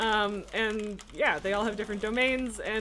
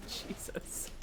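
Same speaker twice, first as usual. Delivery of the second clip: whispered exasperation; 16 dB quieter than usual. Real, not acted. Exasperated under breath.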